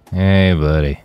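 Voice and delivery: lazy, hazy stoner greeting; lightly voiced stoned buddy; super stoned. A narrator's reading. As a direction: drift through it, blissed out. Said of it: "Roland replied in a voice that was just super stoned".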